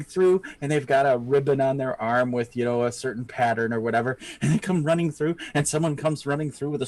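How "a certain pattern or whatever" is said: ordinary talk, nothing marked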